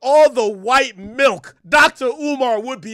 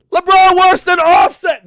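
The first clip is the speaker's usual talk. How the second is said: loud high put-on [name] voice, lightly done; playing [name]; 7 semitones higher, 10 dB louder than usual. Streamer playing [name] dissing LeBron, loud put-on voice. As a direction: bark it like [name] would. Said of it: "Now I'm playing [name]".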